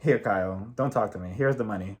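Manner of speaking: low, dismissive aside; mock Sutton brushing off. Imagined Sutton to Kyle, low dismissive mutter. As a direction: hand over the money, low and curt.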